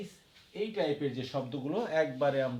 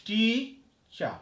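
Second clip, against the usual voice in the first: টি punched higher, then a pause before চা; stress on first syllable; 8 semitones higher, 8 dB louder than usual. Shown as a demonstration. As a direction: lean hard on টি and drop on চা. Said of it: "টি এর উপর জোরালো হয়েছে".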